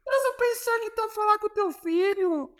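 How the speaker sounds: high-pitched mock coach shout with a Portuguese accent; Jorge Jesus impression. Coach yelling at his player in training, high mock Portuguese voice. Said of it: "ele grita assim".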